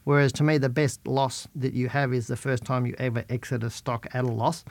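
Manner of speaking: ordinary delivery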